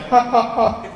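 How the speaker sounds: high staccato mock laugh; imitated laugh. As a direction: act out a buddy's canned laugh. Mocking John's repeated laugh.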